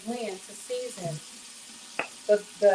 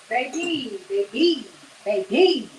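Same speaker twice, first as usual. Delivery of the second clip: building delight; 6 dB louder, much slower than usual. Real, not acted. Admiring the frying pork chops.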